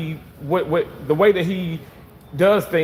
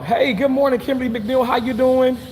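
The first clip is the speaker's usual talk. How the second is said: higher reading voice; 8 semitones higher than usual. Real, not acted. Reading comment aloud.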